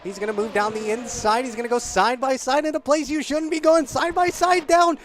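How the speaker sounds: high, loud building race call; excited shouting commentary. Real, not acted. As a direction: build to a shout as the move lands. Commentator calls an overtake.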